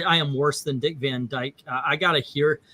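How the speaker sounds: ordinary talk, nothing marked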